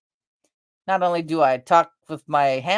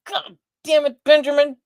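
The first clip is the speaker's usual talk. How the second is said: high mock-angry outburst; fed up, cursing at the mess; high, loud exasperated scolding; exasperated curse; 11 semitones higher, 5 dB louder than usual. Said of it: "I'm like".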